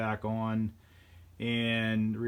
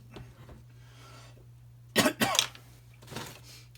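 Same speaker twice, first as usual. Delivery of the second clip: harsh run of coughs; coughing fit. Real, not acted. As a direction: cough hard several times in a row. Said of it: "Again, sorry I'm coughing so much".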